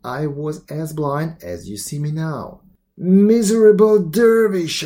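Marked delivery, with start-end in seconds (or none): 3.0-4.9 high, loud, drawn-out accusing cry; anguished storyteller's shriek. A narrator's reading. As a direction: shriek it slowly at the one who wronged you. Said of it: "I shrieked".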